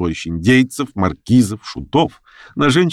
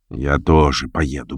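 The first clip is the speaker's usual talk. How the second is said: lower, slow, pensive reply; slow and thoughtful; 7 semitones lower, slower than usual. A narrator's reading. Tabagan decides to go, slow and pensive. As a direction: say it slowly, lost in thought. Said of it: "медленно сказал Табаган".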